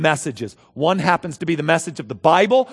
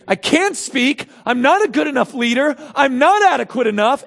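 mock whiny excuse; 10 semitones higher, 6 dB louder than usual. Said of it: "Moses didn't say".

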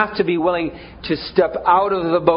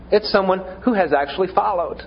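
ordinary talk, nothing marked

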